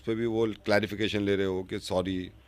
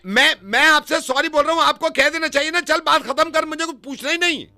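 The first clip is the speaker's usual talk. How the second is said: much higher, loud insistence; mock outrage; 5 dB louder than usual. Prank caller demands stranger forgive him, high loud insistence.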